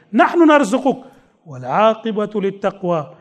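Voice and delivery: fast recited Arabic verse; rapid Quranic recitation. Acted.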